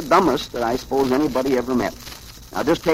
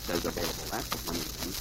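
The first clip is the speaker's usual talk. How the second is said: usual speaking voice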